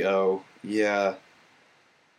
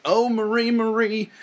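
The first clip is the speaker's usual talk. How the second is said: theatrical lament; 8 semitones higher than usual. Pretend. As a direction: cry out like a tragedian. Theatrical address in letter.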